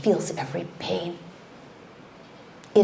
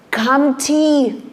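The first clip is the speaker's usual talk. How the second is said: rising cry; 8 semitones higher, 8 dB louder than usual. Acted. The verse cry "I rise.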